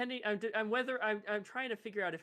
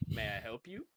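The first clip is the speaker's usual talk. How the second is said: put-on southern accent; 8 semitones lower, faster than usual. Bounty hunter greets the stranger at his cart, southern accent. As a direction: politely offer help in a light southern accent. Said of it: "his deep southern accent".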